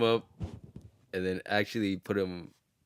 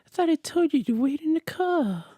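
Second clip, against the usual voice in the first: [name] impression. Scolding Chris for leaving the car, high falsetto [name]. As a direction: chide gently in a high pop-star falsetto. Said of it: "That sounds exactly like it".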